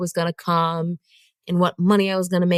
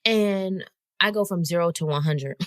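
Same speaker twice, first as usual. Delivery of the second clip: normal talking voice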